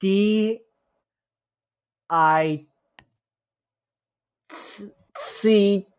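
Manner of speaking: slow letter by letter; spelling it out. Shown as a demonstration. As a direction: spell each letter out slowly. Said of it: "Just sound it out".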